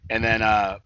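ordinary delivery

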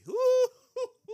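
high, loud whooping cry of joy; joyful whoop. Real, not acted. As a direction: let out a big joyful whoop. Joyous shout while preaching.

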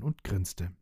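plain reading voice

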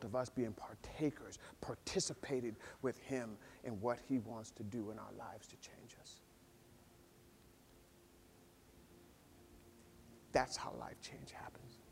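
whispered, unvoiced closing words; whispered close. Real, not acted. Pastor closing sermon to church, whispering. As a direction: hush it for the altar call.

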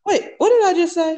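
high, startled self-correction; real surprise at own words; confused surprise. Real, not acted. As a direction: catch your own slip, baffled. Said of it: "that was so funny".

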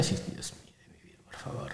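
breathless embarrassed whisper. Retold or imitated. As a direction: whisper it, mortified. Mock embarrassed reply to fans.

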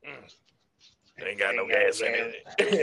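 low, slow Southern mumble; Sling Blade voice. Retold or imitated. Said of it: "And Sling Blade".

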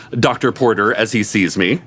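plain reading voice

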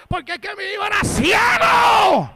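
high shouted testimony; acted mother's outburst; mother crying out. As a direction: wail it out loud, swelling to the end. Voicing the overcome mother.

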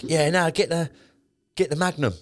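high-pitched mimicry of showy mates; imitating mates trying to outdo each other; mock big-spender. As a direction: egg them on in a silly high voice. Mimics mates showing off at dinner.

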